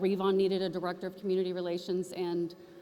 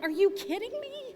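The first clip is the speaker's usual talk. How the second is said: high, disbelieving cry; incredulous; 12 semitones higher than usual. Retold or imitated. Trish reacting as her board loves the project, incredulous cry. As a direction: throw up your hands in disbelief.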